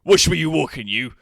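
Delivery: loud, quick challenge; bumped-into stranger. Acted. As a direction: call out indignantly at whoever bumped you. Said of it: "this guy looks up at you and goes".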